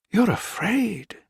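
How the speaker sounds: slow, high, breathless realization; gasped. A narrator's reading. Wife realizing the samurai is afraid, high gasped words. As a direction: gasp it out as it dawns. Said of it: "she gasped".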